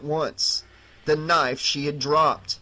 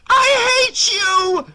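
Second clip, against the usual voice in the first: high, much louder hate-filled scream; furious shout; 7 dB louder than usual. Acted. Kelly screaming her hatred at her brother, loud and high. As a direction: let it burst out of her.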